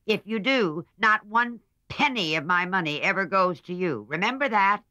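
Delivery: put-on loud, high, imposing old aunt; impressive old lady. A narrator's reading. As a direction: lay down the law as a rich old aunt. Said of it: "said the old lady impressively".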